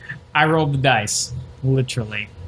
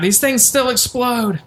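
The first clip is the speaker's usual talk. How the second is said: mock scream; 7 semitones higher than usual. Player voicing their character's cry to the party, mock scream. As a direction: call it out as a half-hearted scream. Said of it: "At that, I scream out".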